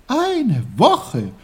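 put-on loud, high boyish exclamation; astonished cry. A narrator's reading. Peter learns he slept a week, crying out. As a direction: cry it out in disbelief. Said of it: "rief Peter".

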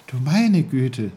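emphatic astonishment on "meine"; amazed Peter. A narrator's reading. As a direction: marvel in a boy's voice.

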